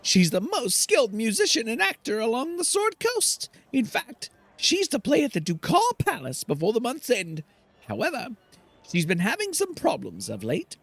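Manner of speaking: a fussy little helper pitching a job; Garrick the servant. Acted. Garrick offering the mercenaries work, high eager voice.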